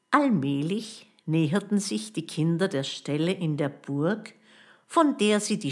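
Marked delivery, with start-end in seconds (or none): none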